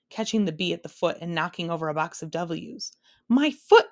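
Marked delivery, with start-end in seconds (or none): 3.3-3.9 very loud, high yelp; pained shout. A narrator's reading. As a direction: cry out in pain. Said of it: "shouted the bee".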